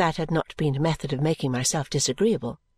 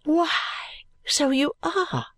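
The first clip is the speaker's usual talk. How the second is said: high, slow, delighted astonishment; drawn-out, pitched-up surprise; astonished; 9 semitones higher, slower than usual. A narrator's reading. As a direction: greet a child in happy amazement. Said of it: "stooping down in astonishment".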